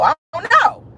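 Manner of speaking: "I don't know" yelled high and loud; shouted I don't know. Shown as a demonstration. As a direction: throw the second "know" up into a shout.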